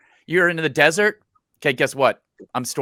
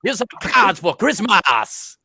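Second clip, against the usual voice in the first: slow, high hammy Cajun drawl; a bad Cajun impression; bad Cajun accent; 6 semitones higher than usual. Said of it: "with his bad Cajun accent".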